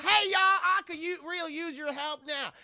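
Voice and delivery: high, loud country holler; cowboy stranger. Acted. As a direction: play a pleading backwoods cowboy. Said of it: "this guy in a cowboy hat".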